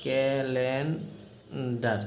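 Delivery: slow demo. Shown as a demonstration. Teacher modelling the word for students, very slow. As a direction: stretch calendar out syllable by syllable, softly. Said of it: "উচ্চারণ একই".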